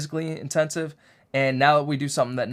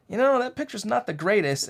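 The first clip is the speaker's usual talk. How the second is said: raised pitch, fussy complaint; high-pitched fussy; 8 semitones higher than usual. Retold or imitated. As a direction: pitch it up, picky and unsatisfied. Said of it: "I'm like".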